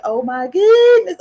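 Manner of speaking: high, loud, slow gasp of shock; shocked exclamation. Retold or imitated. Child after the creature vanished, in a high, loud, slow gasp. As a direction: stretch out the shock. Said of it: "And I said".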